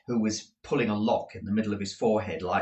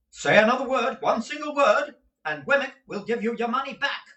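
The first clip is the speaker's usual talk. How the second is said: higher, louder warning, last line fast; threatening lawyer; 6 dB louder than usual. Acted. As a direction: bark the threat. Threat to the women.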